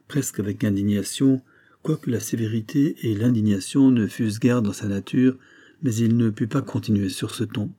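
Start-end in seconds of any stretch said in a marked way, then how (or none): none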